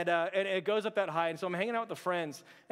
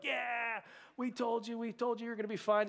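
higher, smug told-you-so voice; smug friends; 6 semitones higher than usual. Retold or imitated. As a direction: gloat like the friends on the ground. Friends gloating after the jump.

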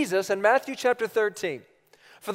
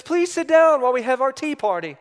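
put-on high, loud little-girl hostess; high, insistent child voice; little daughter; 6 semitones higher, 6 dB louder than usual. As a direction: imitate a small child giving orders, pitched up. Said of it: "And so she says".

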